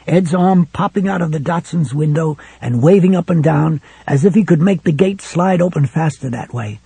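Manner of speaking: ordinary delivery, nothing marked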